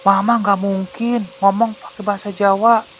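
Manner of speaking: put-on high little boy voice; high-pitched, pleading child; child's voice. A narrator's reading. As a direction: protest to dad as a small child. Child explains to his father.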